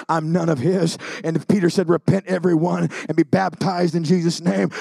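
steady, urgent preaching cadence; earnest preaching. Acted. Preacher warning the congregation about heaven or hell, in an urgent preaching cadence. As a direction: press the warning home like a pulpit appeal.

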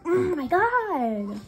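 slow, loud and high exasperated cry; drawn-out wail. Real, not acted. Gamer failing again, slow exasperated wail. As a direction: stretch it out in frustration.

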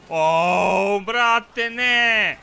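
loud high cry of disbelief; shocked outcry. Real, not acted. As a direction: yell at the sudden loss. Losing a fight in game.

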